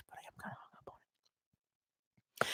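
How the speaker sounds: whispered under the breath. Real, not acted. Streamer whispering a confession to the viewers. Sheepish aside after joking.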